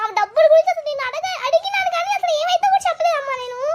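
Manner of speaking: high, whining protest with a laugh; whiny grandson. Acted. Grandson protests to grandma in a high whine. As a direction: plead innocence like a sulky boy. Son complains to mother.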